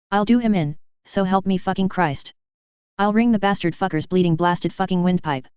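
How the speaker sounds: plain reading voice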